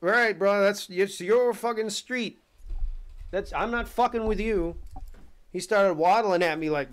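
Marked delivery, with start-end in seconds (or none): 0.0-4.8 high, loud, panicky backing-off; backing away from the hissing turtle, panicked; alarmed backing off. Retold or imitated. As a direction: retreat from the snapping turtle, voice way up. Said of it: "I was like".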